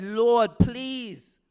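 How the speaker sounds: high, loud, strained plea; travailing prayer. Retold or imitated. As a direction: Hannah begging the Lord, high and loud. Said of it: "She was travailing in prayer".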